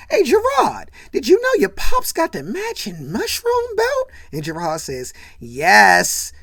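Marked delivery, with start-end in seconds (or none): 0.1-4.1 very high, loud incredulous yell; mocking call-out. Marcus calling to Gerard about his dad's belt, high mocking shout. 5.4-6.3 loud, exasperated yes; embarrassed yes. Retold or imitated. Gerard embarrassed by his pops' outfit, loud blurted answer. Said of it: "he's clearly embarrassed, right?".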